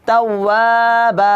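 তাওয়াবা ending on a long plain বা instead of বান; pausal ending. Shown as a demonstration. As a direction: end তাওয়াবা with one vowel stretched. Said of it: "এখানে কি করতে হবে? একটা জবর নিয়ে পড়তে হবে". (তাওয়াবা এক আলিফ টেনে)

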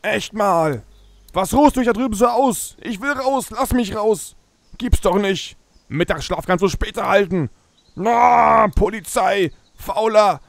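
loud, high teasing scolding; mock scolding. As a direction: scold the lazy cop loudly. Schimpft auf schlafenden Polizisten. (spöttisches Schimpfen)

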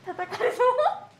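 high, slow and laughing; drawn out through giggles; playful high tease; laughing squeal. Real, not acted. Jokey line amid laughter.

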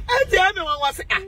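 high, breaking crying voice; fighting back tears as she speaks; emotional cry while thanking friends. Real, not acted.

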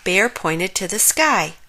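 ordinary delivery

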